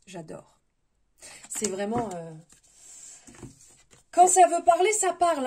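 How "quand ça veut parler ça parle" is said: loud, high, delighted exclamation; excited. Real, not acted. Tarot reader thrilled by the card she drew, excited. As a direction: burst out loudly, pitch jumping up.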